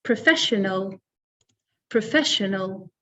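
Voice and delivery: "Professional" as pruh-FESH-uh-nul; second-syllable stress. Shown as a demonstration. Teacher models the word for learners, "professional" stressed on FESH. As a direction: say "professional" twice, weight on FESH. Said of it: "the stress is on the second syllable".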